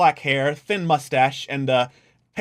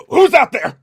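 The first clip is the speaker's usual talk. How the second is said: put-on high wary old-man call; a jumpy old man calling through a door; lightly voiced old man; suspicious old man; 10 semitones higher, 8 dB louder than usual. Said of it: "you hear a voice on the other side, uh, going".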